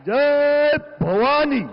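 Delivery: high, loud, slow chant; roaring rallying speech. Real, not acted. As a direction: roar the war cry. Speaker slogan chant. (गरजता जयघोष)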